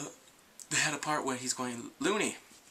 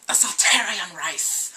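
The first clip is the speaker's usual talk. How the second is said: slow, much louder war cry; warrior's battle cry; 6 semitones higher, 12 dB louder, slower than usual. Retold or imitated. Vlogger voicing the lizard warrior's battle cry, loud and slow. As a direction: roar it like a proud alien warrior. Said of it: "He's like".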